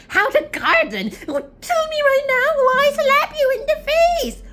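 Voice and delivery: very high, loud mock yelling; hammed-up interrogation. Girl grilling the gardener for answers, hammed-up yelling. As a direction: shriek the demand, overacted. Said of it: "she interrogates Ben and saying".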